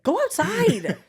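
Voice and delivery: high, loud laughing order; irritated. Retold or imitated. Annoyed at people coughing in the cinema, high laughing order. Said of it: "And it's like".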